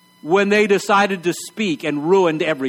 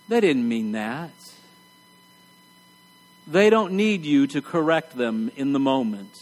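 soft gentle; 5 semitones lower, 3 dB quieter than usual. Acted. Preacher drops to gentle correction.

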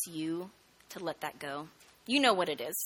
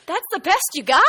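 teasing; 9 semitones higher, 10 dB louder than usual. Retold or imitated. Teen teasing mom's song.

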